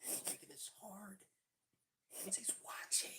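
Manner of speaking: hushed aside, breathy and unvoiced; whispering. Real, not acted. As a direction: whisper it so the viewers won't hear. Stage whisper to partner on camera.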